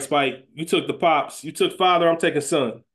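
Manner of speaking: ordinary talk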